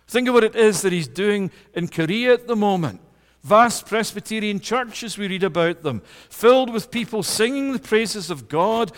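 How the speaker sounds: rally the congregation with rising fervour; impassioned preaching. Acted. Preacher rouses the congregation about God's worldwide people, fervent.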